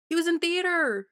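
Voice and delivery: exasperated. Real, not acted. Exasperated repeat at purists.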